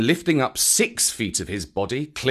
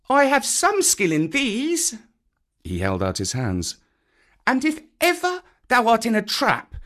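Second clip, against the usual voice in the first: put-on very high, eager child voice; little boy proudly explaining; earnest boy; 10 semitones higher, 3 dB louder than usual. A narrator's reading. Mowgli speaking.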